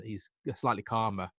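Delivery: usual speaking voice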